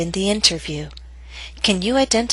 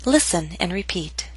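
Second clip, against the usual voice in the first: normal talking voice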